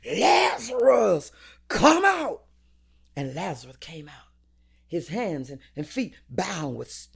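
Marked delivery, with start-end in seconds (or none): 0.0-2.4 full-force commanding shout, raised pitch; loud cry. Acted. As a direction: call out loudly with the name stretched long. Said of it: "he cried out in a loud voice".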